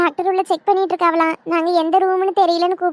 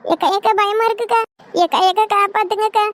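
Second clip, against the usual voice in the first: frightened begging, a touch higher; scared pleading; 3 semitones higher than usual. Acted. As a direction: plead with your sister-in-law for help. Scared wife begs sister-in-law. (பயந்து அக்காவிடம் கெஞ்சும் குரல்)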